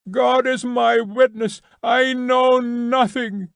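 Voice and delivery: high, slow scream, pitched far up. A narrator's reading. Prisoner screaming his innocence, slow and high. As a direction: wail it high and drawn out. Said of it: "screamed a prisoner".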